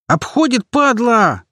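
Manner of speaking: very high, alarmed battle yell; high-pitched shouted curse. A narrator's reading. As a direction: yell the warning to the squad. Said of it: "заорал боец".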